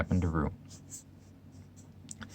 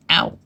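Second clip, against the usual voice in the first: short high yelp; cried "ow"; 4 semitones higher, 3 dB louder than usual. A narrator's reading. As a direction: yelp as if scrubbed too hard. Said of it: "cried Piglet".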